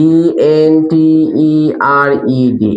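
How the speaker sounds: letters of "এন্টার্ড" said one by one; spelling out letters. Shown as a demonstration. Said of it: "spelling entered".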